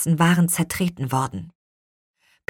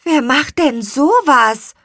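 put-on high, slow puzzled girl; high, loud bewildered question; wondering; 10 semitones higher, 7 dB louder than usual. A narrator's reading. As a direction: ask in astonished disbelief. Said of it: "wunderte sich Lea".

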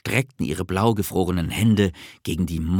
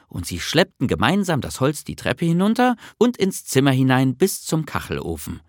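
ordinary delivery

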